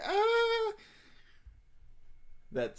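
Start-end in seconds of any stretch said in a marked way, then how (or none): 0.0-0.7 high, louder happy squeal. Retold or imitated. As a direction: melt with happiness. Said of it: "it's like".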